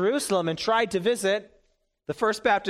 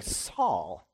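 puzzled, much lower one-word question; incredulous; 10 semitones lower, 4 dB quieter than usual. Pretend. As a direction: ask it in disbelief. Imagined listener's incredulous question.

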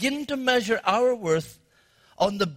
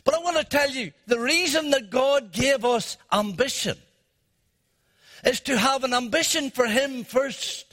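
raised, rising pulpit voice; preaching; 5 semitones higher than usual. Acted. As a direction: build to a full preach.

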